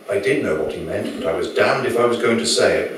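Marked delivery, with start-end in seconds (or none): none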